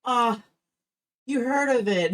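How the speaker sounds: high, loud delighted gasp; excited mom. Retold or imitated. Mom recognizing the joke, high excited. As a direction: squeal it like mom catching the joke. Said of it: "And she went".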